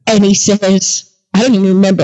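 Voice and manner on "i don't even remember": ordinary delivery, nothing marked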